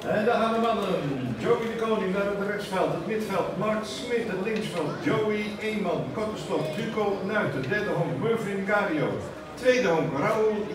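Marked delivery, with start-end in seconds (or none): none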